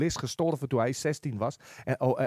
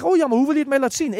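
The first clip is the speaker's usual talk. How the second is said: eager; 9 semitones higher, 6 dB louder, faster than usual. Retold or imitated. Friend asks how, quickly.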